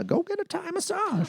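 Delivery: tiny, very high mom voice; mom's voice. Retold or imitated. Mom suggests a Thai massage, high-pitched imitation. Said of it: "my mom said".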